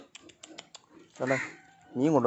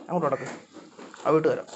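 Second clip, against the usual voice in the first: ordinary delivery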